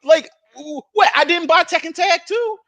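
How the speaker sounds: high, loud, incredulous frustration; exasperated yelling. Retold or imitated. Arguing with a friend about mods, exasperated high yelling. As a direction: yell in disbelief at the accusation.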